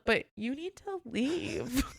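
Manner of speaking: high, drawn-out "leave"; retold order, slowed right down for emphasis; emphatic insistence. Manager telling guest with covid to leave, slow and insistent.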